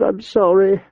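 put-on high, plaintive child's apology; tearful apology. A narrator's reading. Little girl sobbing sorry to her father, mildly acted sob. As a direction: apologise as a small girl upset, lightly acted. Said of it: "she sobbed".

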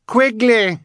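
desperate high scream. A narrator's reading. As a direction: scream his name across the water. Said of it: "she screamed".

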